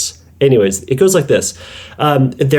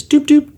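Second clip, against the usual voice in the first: sing-song doop. Acted. Playful vocal filler between counts.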